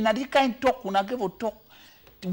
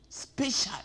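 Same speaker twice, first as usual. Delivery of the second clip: whispered aside; 3 semitones higher, 8 dB quieter than usual. Real, not acted. Aside after rule read.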